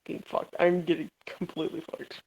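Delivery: ordinary delivery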